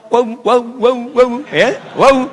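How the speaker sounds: "Waw" said loud and high, lips slightly parted; "waw" repeated as a clear articulation drill; lips slightly apart. Shown as a demonstration. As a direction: demonstrate each "waw" forcefully with rounded, unclosed lips. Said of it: "Agak terpisah".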